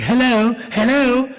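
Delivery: imitated British caller; British accent. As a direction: repeat hello as a baffled Brit. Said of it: "British voice".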